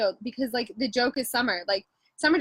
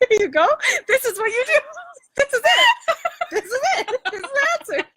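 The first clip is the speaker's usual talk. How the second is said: mock excitement; 13 semitones higher, 4 dB louder than usual. Joking mock revelation.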